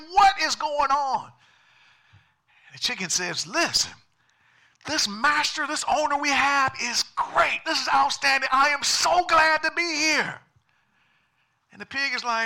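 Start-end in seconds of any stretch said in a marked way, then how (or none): 0.1-1.3 high, loud, drawn-out exasperation; loudly voiced annoyed pig; exasperated pig. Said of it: "animals are getting agitated, frustrated". 3.5-10.4 high-pitched, excited praise; high, loud, delighted chicken; gushing chicken. Acted. Chicken gushing about its owner.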